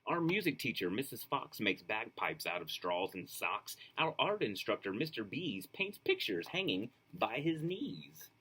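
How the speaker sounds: put-on lively, sing-song reading to children; storybook read-aloud. A narrator's reading. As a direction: read it like a bedtime picture book. Reads picture book aloud.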